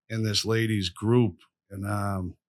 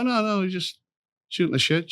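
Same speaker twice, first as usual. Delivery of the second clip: higher-pitched retold brush-off; acted nothing-to-see-here reply; feigned casualness; 9 semitones higher than usual. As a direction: play innocent, lightly waving off concern. Retells brushing off the concerned caseworker.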